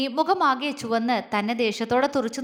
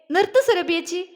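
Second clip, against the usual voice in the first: shouting stop; 5 semitones higher than usual. A narrator's reading. Jwala cuts off Surabhi's accusation with a shout. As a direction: yell at her to stop, eyes shut. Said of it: "അലറി".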